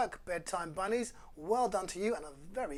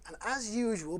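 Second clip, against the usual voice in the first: ordinary delivery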